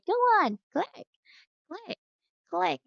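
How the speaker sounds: high, sing-song "click, click, click"; demonstrating. Shown as a demonstration. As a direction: lead the child in clicking, voice up high. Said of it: "Follow teacher".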